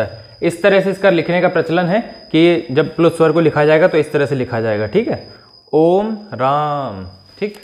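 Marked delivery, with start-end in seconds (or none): 5.7-7.1 ओम राम slow, each vowel held three beats; stretched ओम राम; pluta vowels drawn out. Shown as a demonstration. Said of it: "आप इसका उच्चारण करेंगे प्लुत स्वर का".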